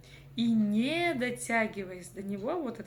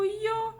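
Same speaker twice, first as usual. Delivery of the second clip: «ё» высоко, громко и протяжно; пропой голосом отдельное «ё»; выделенная буква; 11 semitones higher, 5 dB louder than usual. Shown as a demonstration. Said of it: "взяли за основу букву". (звонкое растянутое «ё»)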